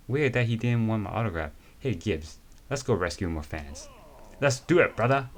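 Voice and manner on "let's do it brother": loud, high pumped-up cheer; rally your buddy loudly; loud pumped-up reply to his partner; hyped up. Acted.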